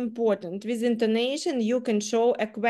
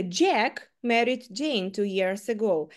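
Jack punched and held, the rest falling away; stress on Jack; slower than usual. Shown as a demonstration. Teacher demonstrates stressing Jack for the class. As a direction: hit the word Jack. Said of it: "я саме зробила наголос на Джеку".

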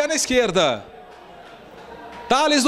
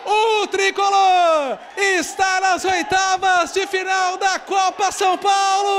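explosive goal commentary; 7 semitones higher than usual. Real, not acted. Keeper saves deciding penalty.

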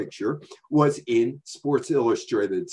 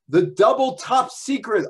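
higher, louder ad-man delivery of "The double top secret"; commercial announcer voice; 10 semitones higher, 5 dB louder than usual. Retold or imitated. Retelling a childhood TV ad, loud announcer voice. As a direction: sell it like a kid's TV ad.

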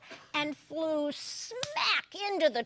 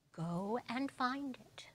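soft unvoiced whisper; whispered command; 10 dB quieter than usual. God sending Ziz off again, whispered. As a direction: hush it out gently. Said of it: "said God".

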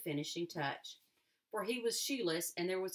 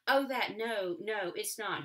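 louder, hurried no no; quick alarm; 2 semitones higher, 6 dB louder than usual. A narrator's reading. Boy warns girl off the spring water, hurried alarm. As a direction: rush to stop her drinking. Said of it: "he said quickly".

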